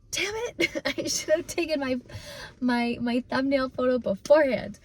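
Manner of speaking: tearful laughter, half amused; laughing at herself. Real, not acted. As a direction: laugh at your own mistake as you speak. Laughs at failing to hold back tears.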